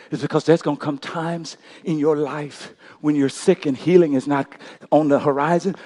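usual speaking voice

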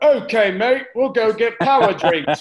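loud, high, hammed-up British accent; terrible British accent. Imitating a British friend offering energy drinks, terrible British accent. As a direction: do an awful, overblown British mate. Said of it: "worst British accent I've ever heard".

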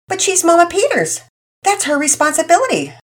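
a father mimicking his kid's excuse; mock child voice.

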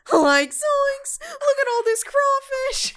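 high, shouted cartoon Shaggy. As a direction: yell like a panicked cartoon slacker. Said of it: "the Scooby-Doo movie".

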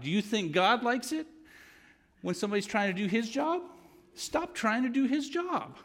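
very high, loud scolding voice; imitating mentor. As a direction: needle him like a sharp old southern lady. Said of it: "and she'd say".

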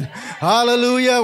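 ordinary talk

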